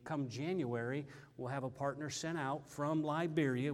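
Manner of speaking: normal talking voice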